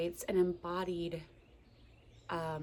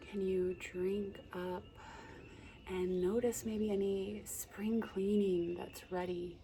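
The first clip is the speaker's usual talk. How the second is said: guided meditation; 4 dB quieter than usual. Acted. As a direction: gently guide the listener's breath. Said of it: "a little guided meditation for you".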